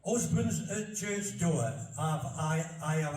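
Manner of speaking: slow, broad Barnsley reading; slow recital in thick Yorkshire dialect. Shown as a demonstration. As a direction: read the old verse in broad Yorkshire. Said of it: "when you read it in Old English".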